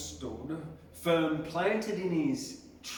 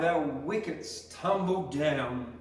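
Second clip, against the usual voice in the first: ordinary delivery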